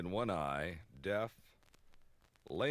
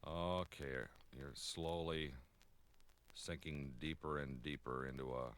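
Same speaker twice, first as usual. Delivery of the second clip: hypnotist voice; 7 semitones lower, 7 dB quieter than usual. Pretend. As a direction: lull him under like a stage hypnotist. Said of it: "I'm gonna hypnotize you".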